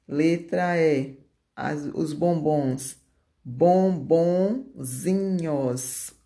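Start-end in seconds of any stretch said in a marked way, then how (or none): 3.4-6.1 "Bombonzinhos" drawn out piece by piece; stretched, segmented "Bombonzinhos"; slow dictation. Shown as a demonstration. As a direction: dictate "Bombonzinhos" slowly, pausing before the ending. Teacher dictates the diminutive in parts.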